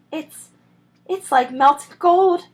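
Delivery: breathless gasp. Acted. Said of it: "he gasped".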